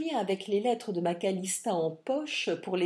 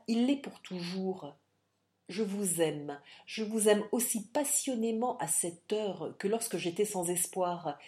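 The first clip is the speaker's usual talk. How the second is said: ordinary delivery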